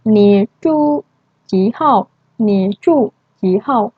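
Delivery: slow, deliberate Mandarin question, said twice; slow model question. Shown as a demonstration. Teacher modelling a question for students, slow and deliberate. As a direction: draw out each syllable. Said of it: "Nah, contohnya".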